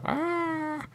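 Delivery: high mock "ah"; mock reaction. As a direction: squeak a small disappointed ah. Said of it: "I go".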